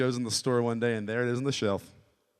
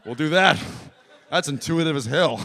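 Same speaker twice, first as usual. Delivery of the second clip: loud, higher-pitched eager approval; mock enthusiasm; 4 semitones higher, 7 dB louder than usual. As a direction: exclaim it like a thrilled product tester. Acts out delighted Samsung people.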